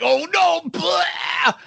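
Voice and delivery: high wailed "oh no" into a vomit noise; mock retching. Nauseous rider hears the ride go again, mock retching.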